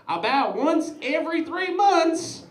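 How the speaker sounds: high, slow mock confession; imitated addict confessing. [name] confessing his sofa habit on video, imitated addict voice.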